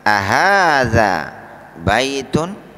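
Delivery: Ahadza baitun drawn out slowly with a rising question; teacher's slow demo question; slow model reading. Shown as a demonstration. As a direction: model the Arabic question slowly for students to repeat. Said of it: "Jadi kami ulangi".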